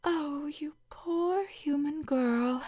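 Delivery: slow, soft whispered pity. Acted. Alien to student, slow whispered pity.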